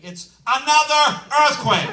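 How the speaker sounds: slow, loud, stretched ANOTHER; exasperated comic shout; shouted punchline. Acted. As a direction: yell it, stressing Another.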